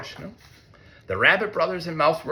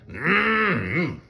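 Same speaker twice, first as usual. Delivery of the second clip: mmm of relish; 5 semitones higher than usual. Pretend. Imitating Bear licking honey.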